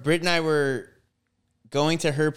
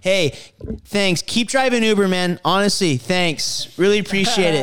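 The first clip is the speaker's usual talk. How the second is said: sarcastic thanks; 5 semitones higher, 5 dB louder than usual. Retold or imitated.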